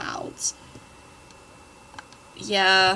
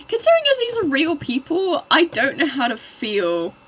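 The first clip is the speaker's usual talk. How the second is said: flustered; 8 semitones higher, 6 dB louder than usual. Real, not acted. Reacting to cringey fanfic.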